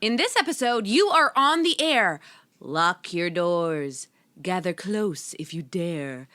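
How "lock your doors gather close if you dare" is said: horror host voice. Acted. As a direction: welcome them to the crypt, slow and eerie. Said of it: "I love your horror host voice".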